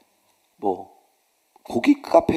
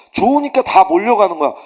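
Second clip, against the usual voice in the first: louder, higher preaching; rising emphasis; 6 semitones higher, 7 dB louder than usual. Real, not acted. Preacher pressing the congregation, rising emphasis. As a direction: build the point with force.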